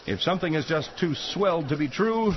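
grave narrator read; mock serious newsreel voice; documentary narration.

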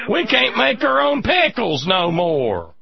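high, slow hillbilly with a laugh; laughing hillbilly drawl; hillbilly accent. Retold or imitated. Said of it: "with the hillbilly accent".

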